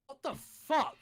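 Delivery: high, loud angry yell; angry shout. Acted. Reader voices Dumbledore's angry outburst, high and loud. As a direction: snap it out in outrage. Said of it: "shouted angrily".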